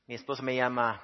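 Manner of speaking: ordinary talk, nothing marked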